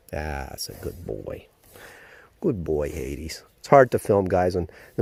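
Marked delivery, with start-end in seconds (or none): 0.1-3.4 slow, soft praise to a horse; soothing praise. Real, not acted. Calming colt into new stall.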